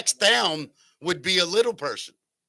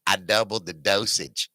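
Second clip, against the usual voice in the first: costumed cannoneer; 8 semitones lower than usual. Retold or imitated. Cannon guy's punchline, laughing.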